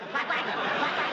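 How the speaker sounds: forceful repeated quacks; emphatic duck-call imitation. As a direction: pump out insistent duck quacks.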